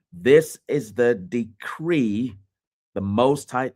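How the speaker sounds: "decree" dwelt on, slow and weighty; stress on decree. Shown as a demonstration. Preacher to the congregation, stressing the word decree. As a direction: lean hard on "This is the decree". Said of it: "emphasize the word decree".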